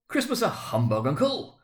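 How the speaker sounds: can't believe his ears, rising up; incredulous. A narrator's reading. Nephew astonished at his uncle, high incredulous cry. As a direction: voice leaping high on Uncle in disbelief. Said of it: "said Scrooge's nephew".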